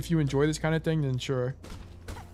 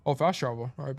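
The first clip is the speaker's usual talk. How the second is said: ordinary talk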